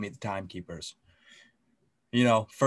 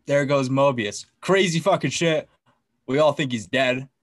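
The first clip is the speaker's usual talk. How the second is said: normal talking voice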